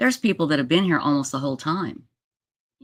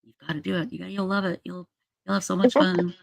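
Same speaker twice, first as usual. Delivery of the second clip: mock nagging, higher and softer; soft, higher-pitched mimicry of a pest; imitated pestering; 4 semitones higher, 4 dB quieter than usual. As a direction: lightly imitate someone pleading and coaxing. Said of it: "she just wouldn't stop harassing me".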